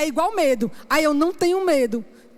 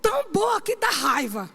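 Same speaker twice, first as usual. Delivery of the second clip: mock exasperated nephew; 5 semitones higher than usual. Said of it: "Ele disse".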